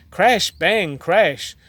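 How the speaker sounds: usual speaking voice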